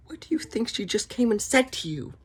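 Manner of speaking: high, sarcastic squeak; mocking high voice. Vlogger mocking Elrond in a high squeaky voice. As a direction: jeer at him in a squeaky voice.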